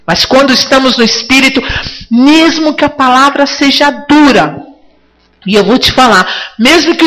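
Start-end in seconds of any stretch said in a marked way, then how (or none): none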